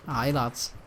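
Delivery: ordinary talk, nothing marked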